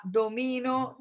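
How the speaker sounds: slow "domino", stressed on MI; do-MI-no, present tense, stretched out; stress on middle syllable. Shown as a demonstration. Teacher contrasting accent examples, "domino" stressed on MI. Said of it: "mi ejemplo iba a".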